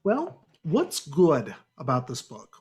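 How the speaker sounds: ordinary delivery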